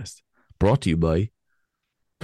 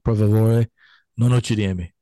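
louder, hammed-up Italian plea; a touch of a mock Italian plea; 5 dB louder than usual. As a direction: plead in playful Italian. Italian phrase for tourists.

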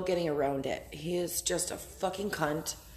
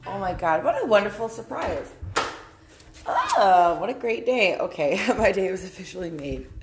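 loud, raised, gushing delight; 6 dB louder than usual. Real, not acted. Vlogger thrilled by a surprise package, delighted and loud. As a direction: gush over a wonderful surprise.

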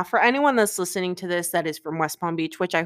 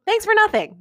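sarcastic; 14 semitones higher, 5 dB louder than usual. Real, not acted. Sign-off to ex on podcast.

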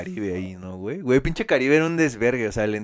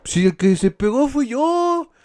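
high, slow sarcastic quip; sarcastic reply; 14 semitones higher, 6 dB louder, slower than usual. Retold or imitated. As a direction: drawl it out mockingly. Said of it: "El otro güey".

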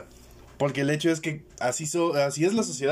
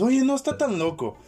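much higher, half-convinced voice of the crowd; mock surprised convert; 10 semitones higher than usual. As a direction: act like people starting to believe. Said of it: "pero después".

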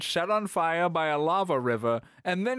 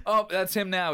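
ordinary delivery

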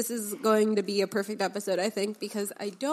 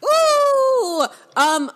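surprised; 9 dB louder than usual. Real, not acted. Reacting to coincidence.

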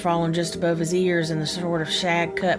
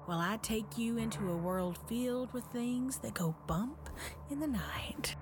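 spooky host voice; 3 semitones higher, 15 dB quieter than usual. Acted. Spooky podcast host intro.

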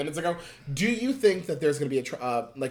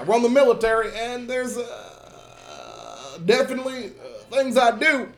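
Obama impression; 7 semitones higher, 4 dB louder than usual. Shown as a demonstration. Obama bit, both speakers.

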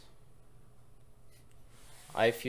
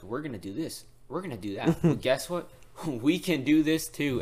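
mocking boast; 5 semitones higher, 6 dB louder than usual. Mock boast of rival nations.

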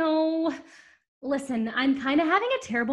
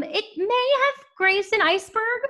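fake cheery; 9 semitones higher, 3 dB louder than usual. Stewardess makes light of disaster.

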